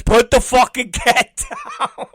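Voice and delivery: barking an order through laughter; can't hold the laugh while quoting; laughing fake command; laughing mock order. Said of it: "Schleck says".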